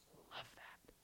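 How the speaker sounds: soft whispered I love that. Acted. God speaking to a broken spirit, whispered. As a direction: whisper it as God would, tenderly.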